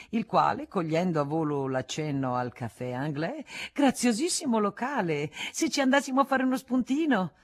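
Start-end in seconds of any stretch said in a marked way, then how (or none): 3.7-7.3 high, louder, eager suggestion; Hussonnet's voice. Acted. Hussonnet proposes a snack at the Café Anglais, eager and high. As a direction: jump in cheerfully with the idea. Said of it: "sopraggiunto Hussonnet".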